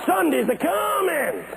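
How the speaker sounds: high, stretched-out proclamation; preaching picking up. Retold or imitated. Old pastor proclaims hope to congregation, picking up fervor. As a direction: ring it out long and high like a preacher. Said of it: "they're beginning to pick up now".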